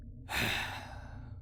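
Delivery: long unvoiced weary sigh; tired sigh. Necromancer waking late, tired sigh. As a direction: sigh like you just woke up. Groggy morning after oversleeping.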